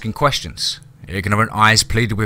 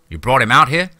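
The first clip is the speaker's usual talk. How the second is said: louder, higher startled question; lieutenant surprised at the landowner's move; 4 semitones higher, 6 dB louder than usual. A narrator's reading. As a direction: catch him off guard, a touch louder. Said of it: "the man asked in surprise".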